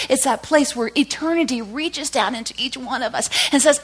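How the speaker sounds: high and loud, slowing at the start; full-force preaching. Acted. Preacher proclaiming God's love to the church, fervent. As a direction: pour the conviction out.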